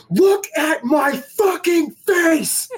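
high, slow, drawn-out shout; re-enacted furious yell. Player challenging the trash talker to meet afterwards, high angry shout. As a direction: stretch every word into a high challenge.

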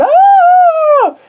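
long, high-pitched mock scream; drawn-out high "aah" of fright; retold scream. Nemo sees the nightmare, high mock scream.

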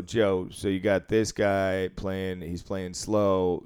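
slow, drawn-out explanation to the boss; deliberately slow report. Retold or imitated. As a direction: spell it out slowly for him. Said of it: "I go".

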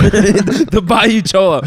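mock Mexican accent. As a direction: stretch it out in a hammed-up Mexican accent. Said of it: "their accents. Or Mexican".

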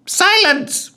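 high, loud screech. A narrator's reading. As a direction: screech it at him. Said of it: "screeched the ghoul".

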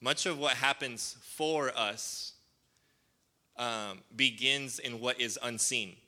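slow for note-takers, every word weighed; slow, measured key line; slow, deliberate dictation. Shown as a demonstration. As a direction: read it out slowly so they can write it down. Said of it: "listen to the wording, and if you're taking notes".

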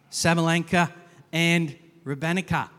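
loud, self-mocking attempt at an Australian accent. Shown as a demonstration. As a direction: say the players' names in a hammed-up Australian accent. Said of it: "My best Australian accent and all".

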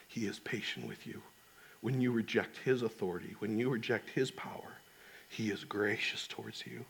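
very soft, gentle fatherly voice; tender reassurance. Retold or imitated. Father answering his tearful young daughter about God's love, tender and hushed. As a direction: reassure softly, with little pauses between phrases.

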